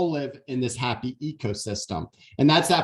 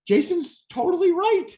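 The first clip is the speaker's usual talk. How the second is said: excited agreement; 12 semitones higher, 4 dB louder than usual. Retold or imitated. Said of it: "I was like".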